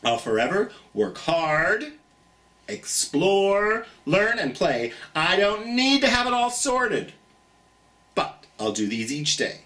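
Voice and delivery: plain reading voice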